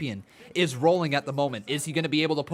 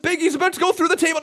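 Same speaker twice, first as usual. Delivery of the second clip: high, loud excited yelling; excited shout; 8 dB louder than usual. Real, not acted. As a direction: shout it as it happens. Watching a spot unfold live.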